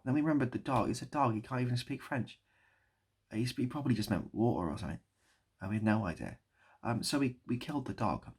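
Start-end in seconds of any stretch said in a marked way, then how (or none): none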